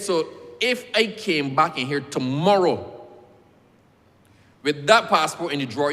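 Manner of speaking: loud, slow, pointed question; hot-headed challenge. Retold or imitated. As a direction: corner the clerk with it. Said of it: "my head used to be hot".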